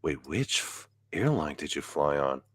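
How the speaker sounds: low, soft imitation of a worried friend; Jason's wary question. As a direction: ask it quietly, with dread creeping in. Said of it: "in Jason's voice".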